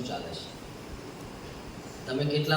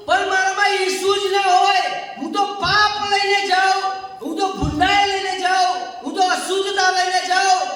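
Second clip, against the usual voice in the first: loud, raised pulpit cry; preaching at full force; 6 dB louder than usual. Acted. Preacher confessing to congregation, loud and raised. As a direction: build to a shout for the church.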